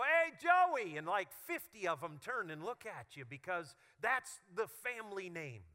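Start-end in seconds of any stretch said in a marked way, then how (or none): 0.0-1.0 loud, high call across a crowded room. Retold or imitated. Pastor calling across a family reunion, loud shout. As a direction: shout the name to get his attention. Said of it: "And you go".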